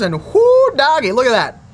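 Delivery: a loud, high whoo of joy; excited whoop. Real, not acted. Thrilled with the fillet.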